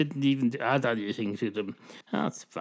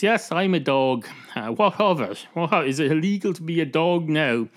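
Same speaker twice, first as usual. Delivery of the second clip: louder, higher indignant retort; defiant dog; 5 semitones higher, 4 dB louder than usual. Acted. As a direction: challenge the barman, put out. Dog challenges the barman.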